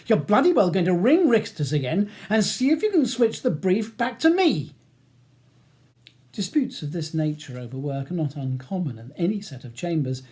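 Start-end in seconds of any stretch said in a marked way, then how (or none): 0.1-4.7 loud, high-pitched angry demand; aggressive. A narrator's reading. Barrister ordering his clerk to ring the solicitors, aggressive. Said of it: "so he ignores Ellison's aggressive tone".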